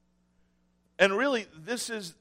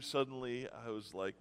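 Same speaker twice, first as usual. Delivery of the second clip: ordinary talk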